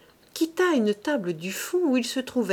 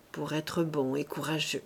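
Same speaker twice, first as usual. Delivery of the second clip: much softer, lower, measured words; slow quiet verdict; 6 semitones lower, 6 dB quieter than usual. A narrator's reading. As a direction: let the praise drop slowly. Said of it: "ajouta Claude lentement".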